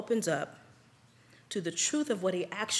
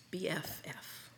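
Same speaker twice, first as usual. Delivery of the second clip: a dry, whispered aside; sarcastic whisper; 4 semitones lower, 15 dB quieter than usual. Real, not acted. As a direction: whisper it as an ironic jab. Sarcastic aside about the friends.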